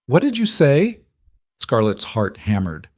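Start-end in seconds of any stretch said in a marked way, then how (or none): 0.1-1.0 stunned, high disbelieving question; surprised. Acted. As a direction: caught completely off guard.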